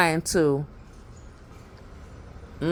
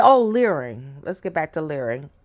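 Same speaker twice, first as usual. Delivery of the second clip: ordinary talk, nothing marked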